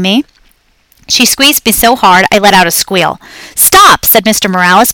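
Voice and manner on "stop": higher, louder sudden stop; sharp stop. A narrator's reading. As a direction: cut her off sharply like a dad. Father stopping rough child.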